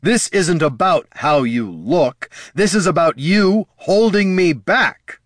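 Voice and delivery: angry. Acted. Son confronting his father.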